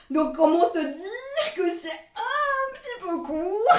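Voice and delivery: slow, high, drawn-out comic wail; mock dismay. Vlogger showing viewers the too-short skirt, comically wailing. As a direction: stretch it into a playful high whine.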